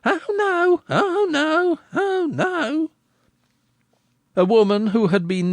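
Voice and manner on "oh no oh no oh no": put-on high, jeering chant of boys; mocking shout. A narrator's reading. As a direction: taunt the crier back in a sing-song. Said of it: "the boys mockingly shouted".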